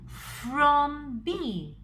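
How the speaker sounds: "from" drawn out high and loud; loud, lingering emphasis on "from"; stress on from. Shown as a demonstration. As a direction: stretch and lift "from" before the letter. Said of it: "I'm putting emphasis on the word from".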